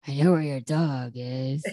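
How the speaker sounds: very low gruff kidnapper impression; Joey's gravelly voice. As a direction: drop way down for a mock ransom call. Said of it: "what cracks me up is he's all".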